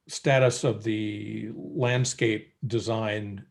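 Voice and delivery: ordinary talk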